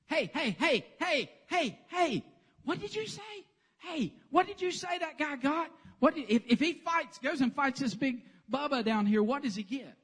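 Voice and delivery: put-on high, loud, eager kid-brother voice; pestering little brother. Little brother badgering the soldiers, high and eager.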